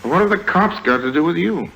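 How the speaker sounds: high, sharp disbelief; suddenly suspicious; high suspicious question; incredulous. Acted. Suspicious question about police.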